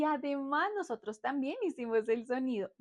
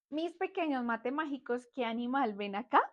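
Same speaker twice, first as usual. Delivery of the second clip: ordinary delivery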